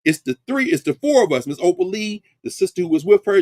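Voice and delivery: ordinary talk, nothing marked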